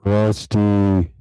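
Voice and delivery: very loud, drawn-out call. Real, not acted. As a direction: holler to wake a dozing friend. Calling to sleeping co-host.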